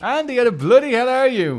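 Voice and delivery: high, loud and jolly; hearty loud greeting. Pretend. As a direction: greet your mate loudly.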